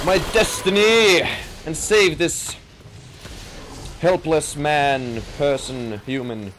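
grand stage-hero voice with archaic pomp; mock-tragic hero. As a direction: declaim like a hammy Shakespearean hero. Mock archaic heroic lament.

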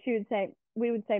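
ordinary delivery

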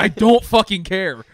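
loud, high-pitched fed-up outburst; exasperated. Retold or imitated. Viewer sick of the endless race scene, exasperated. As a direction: throw it at the screen in frustration.

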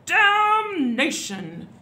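long, drawn-out high bellow; outraged storybook roar; angry shout. A narrator's reading. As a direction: bellow the curse slowly. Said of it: "shouted the Duke".

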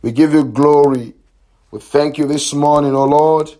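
ordinary delivery

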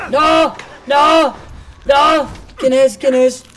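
panicked shouting. Real, not acted. Ambushed and drugged in game.

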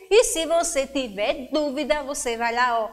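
ordinary talk, nothing marked

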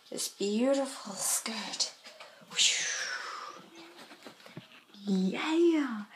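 hushed whisper for the skirt; whispered reveal. Acted. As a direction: breathe it out like a secret.

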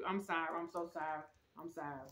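soft, low, fading apology; hushed apology. Real, not acted. Host apologising to baby after swearing, hushed apology.

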